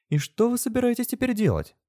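very high, prim question; high-pitched, disapproving inquiry; pursed-lip disapproval. A narrator's reading. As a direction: ask it primly through pursed lips. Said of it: "поджала губы Андриана".

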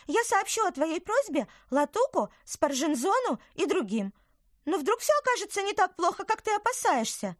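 high, bright little woodland creature; chirpy tiny creature, earnest; little apple creature. Acted. Tykver speaks.

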